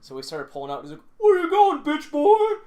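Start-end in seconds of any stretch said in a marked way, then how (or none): 1.2-2.6 put-on high, loud drunken taunt; drunk yell. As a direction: bellow it like a drunk. Drunk guy yells at them.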